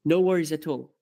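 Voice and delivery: ordinary delivery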